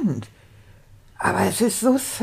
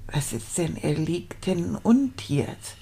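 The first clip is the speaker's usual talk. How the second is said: ordinary delivery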